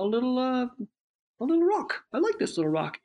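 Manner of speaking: high, delighted fish finding a rock; a tiny goldfish marvels at a pebble; little fish voice. Retold or imitated. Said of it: "talking in the fish's voice".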